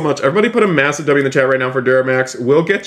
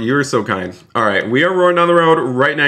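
ordinary talk, nothing marked

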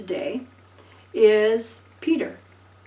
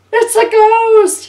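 much higher, very loud, slow terrified cry; freaking out; 12 semitones higher, 13 dB louder, slower than usual. Retold or imitated. Disciples in the boat seeing a ghost, panicked scream. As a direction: shriek it like a panicked fisherman. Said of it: "they're freaking out".

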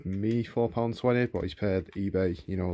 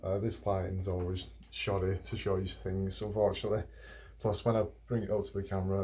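normal talking voice

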